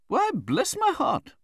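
very high, slow cry of surprise; astonished exclamation. A narrator's reading. Aunt sees the young widow's face, astonished. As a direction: exclaim as a startled, imperious aunt. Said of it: "exclaimed Miss Betsy".

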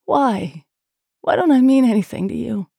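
tearful plea. A narrator's reading. Woman near tears asks her lover why, pleading. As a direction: cry it out, wounded. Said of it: "tears forming".

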